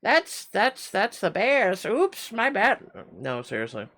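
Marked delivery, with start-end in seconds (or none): 0.0-2.8 very high, bright little character voice; Leaf's voice. Acted. The Leaf character apologizing to viewers, very high. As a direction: squeak it up high like a tiny cartoon sidekick. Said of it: "the leaf voice".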